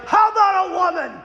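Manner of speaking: high, loud, pointed taunt; taunting challenge. Retold or imitated. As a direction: hold it out and dare him. Exorcist challenges demon.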